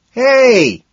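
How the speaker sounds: high, loud call; loud greeting yell; shouted hey. A narrator's reading. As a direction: holler for attention over music. Calling out in noisy club.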